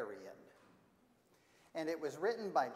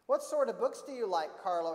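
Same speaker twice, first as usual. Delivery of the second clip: loud, high-pitched librarian question; librarian character; 5 semitones higher, 9 dB louder than usual. Acted. Missus Chinka asks Carlo about books, loud librarian voice. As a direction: project it boldly at a shy child.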